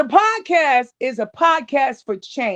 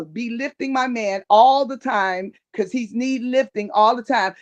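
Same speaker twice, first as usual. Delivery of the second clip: ordinary talk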